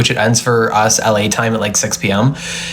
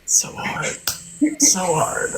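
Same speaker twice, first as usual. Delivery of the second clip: breathy whispered fake grief; softly hammed-up heartbreak; mock sad whisper; 5 semitones higher, 7 dB quieter than usual. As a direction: whisper it as if overcome, but faking. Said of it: "I'm like".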